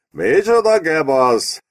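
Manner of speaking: high, drawn-out exclamation; exclaiming king. A narrator's reading. King reacting to the agent's confession, high drawn-out exclamation. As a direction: cry out slowly, pitched well up, as an amused king. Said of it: "Kiáltott fel a király".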